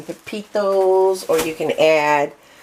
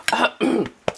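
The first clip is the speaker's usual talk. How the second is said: quick throat clear; 7 semitones higher than usual. Real, not acted. Clears throat mid-sentence.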